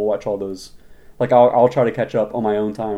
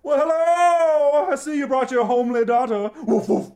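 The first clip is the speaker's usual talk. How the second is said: pompous king voice; 10 semitones higher than usual. Acted. King voiced in radio play.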